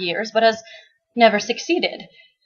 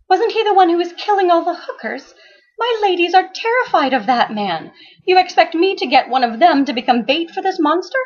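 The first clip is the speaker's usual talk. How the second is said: higher, indignant madam voice; Becky's voice; 9 semitones higher than usual. Acted. Madam scolding the detective, higher and indignant. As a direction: voice the outraged madam.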